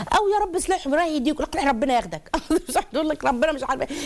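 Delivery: exasperated mother cursing, pitched high; mock a fed-up mother's curse; mock angry parent. Preacher mocking mothers who curse their kids, high exasperated.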